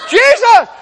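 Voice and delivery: loud, very high exclamation; hammed-up loud high call; loud high cry. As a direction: shout the name out in comic despair. Crying out, lost in huge bed.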